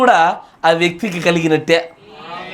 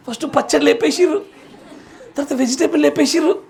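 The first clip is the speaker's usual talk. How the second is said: joking with laughter; 6 semitones higher, faster than usual. Real, not acted. Joking about food being cut.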